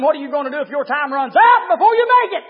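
rising to a loud, high shout; loud preacher's warning question; urgent challenge. Retold or imitated. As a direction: confront him, hollering by the end. Urgent challenge to the young man.

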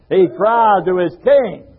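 ordinary talk

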